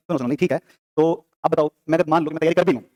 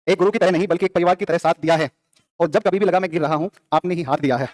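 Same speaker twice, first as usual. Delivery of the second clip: measured reading of a tribute poem; poem recitation. Real, not acted. Teacher reads a student's poem to the class, poem recitation. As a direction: read out heartfelt verses, line by line.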